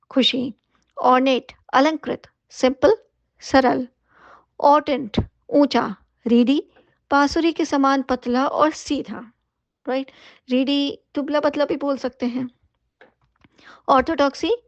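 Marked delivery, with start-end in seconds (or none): none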